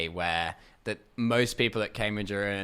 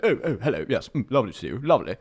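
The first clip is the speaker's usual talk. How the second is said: plummy upper-class greeting, louder than usual; very posh man; 3 semitones higher, 5 dB louder, faster than usual. Retold or imitated. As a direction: imitate an ultra-posh Australian who lost his accent. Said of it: "that was his voice".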